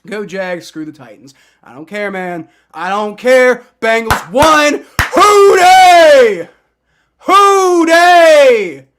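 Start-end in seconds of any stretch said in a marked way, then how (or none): none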